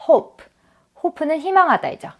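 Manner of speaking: usual speaking voice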